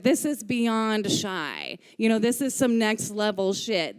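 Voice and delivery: normal talking voice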